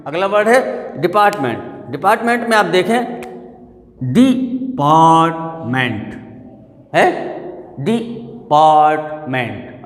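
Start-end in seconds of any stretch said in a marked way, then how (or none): 4.1-6.1 DEPARTMENT very slowly in three separate pieces; broken into syllables. Shown as a demonstration. Teacher splitting a word for students, syllable by syllable. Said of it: "अलग-अलग किया हमने". 7.8-9.8 DEPARTMENT drawn out in three slow chunks; broken into syllables. Shown as a demonstration. Teacher showing students three syllables, broken apart. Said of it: "अलग-अलग किया हमने".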